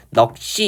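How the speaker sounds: 넋이 with a tense double s, 넉씨; tensed linking. Shown as a demonstration. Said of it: "이렇게 발음하면 되고요".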